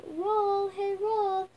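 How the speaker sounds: loud, high call to the roll. Acted. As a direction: call it like a pet. Calling to a bread roll.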